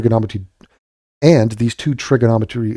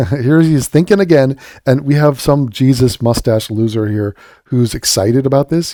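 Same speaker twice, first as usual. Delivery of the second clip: ordinary talk, nothing marked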